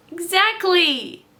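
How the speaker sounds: high, loud and slow spat. Acted. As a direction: spit it out furious. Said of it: "spat Frank".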